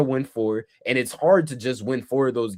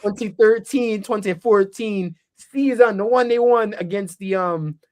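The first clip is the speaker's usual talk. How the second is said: put-on Jamaican accent; 7 semitones higher, 5 dB louder than usual. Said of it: "your Jamaican accent".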